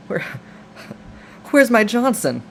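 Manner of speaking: high, loud mock panic; a hammed-up yelp of dismay; imitating a man shocked by his new body; horrified cry. Reanimated Jeffrey finds himself changed.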